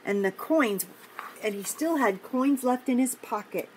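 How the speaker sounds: gentle storybook read-aloud; reading to children. Acted. Teacher reading a picture book to kids, gentle storytime voice. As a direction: read it like a storytime for little ones.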